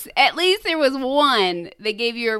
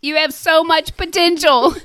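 higher, louder joke-telling shout; mock urgent yell; 7 semitones higher, 8 dB louder than usual. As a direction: yell it like a pleading bystander. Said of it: "He yells".

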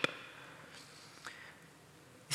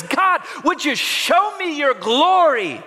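excited plea; 8 semitones higher, 3 dB louder than usual. Acted. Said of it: "he shouts".

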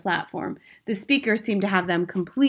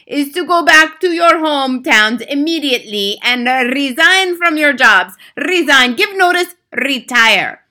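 loud, high thundering order; 8 semitones higher, 12 dB louder than usual. Acted. Grand High Witch thundering orders at the witches, loud. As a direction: boom the order at them. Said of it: "thundered the Grand High Witch".

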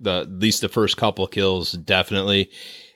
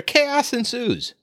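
dramatic announcement; 14 semitones higher, much slower than usual. Acted. Dramatic end to plot summary.

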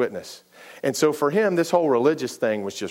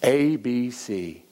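very slow, each letter apart; A B C spelled out slowly; much slower than usual. Shown as a demonstration. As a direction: teach it like to children. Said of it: "something from Vacation Bible School".

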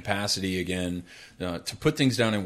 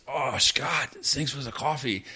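put-on lightly done German accent, slightly raised pitch; [name]'s German accent; 4 semitones higher, 3 dB quieter than usual. Just-woken soldier thanking his partner, light German accent. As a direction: imitate a German soldier, softly grateful. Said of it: "he was German".

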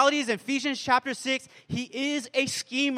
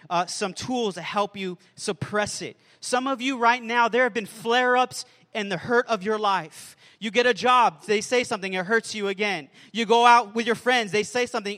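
ordinary delivery

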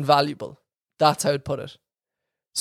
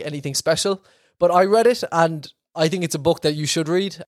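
usual speaking voice

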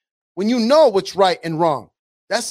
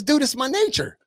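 casual scorpion; 5 semitones higher, 4 dB quieter than usual. Retold or imitated. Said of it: "the scorpion says".

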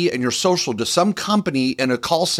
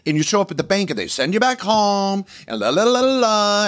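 mocking singsong; 7 semitones higher than usual. Mocks clients sent home from bank.

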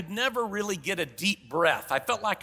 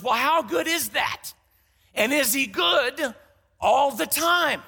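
skeptical follow-up; 6 semitones higher, 3 dB louder, slower than usual. Retold or imitated. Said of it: "your next question is".

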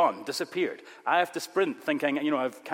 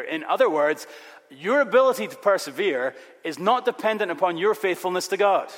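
ordinary delivery

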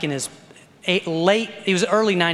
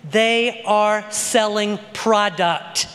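slow, each word set apart; emphatic declaration; much slower than usual. Real, not acted. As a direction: spell it out word by word, slowly. Slows a claim for emphasis.

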